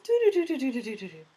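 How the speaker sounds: high, fast prissy doo-doo hum; haughty sing-song doo-doo-doo; snooty humming. Retold or imitated. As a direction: hum like a stuck-up nurse sashaying in. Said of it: "all highty-tighty".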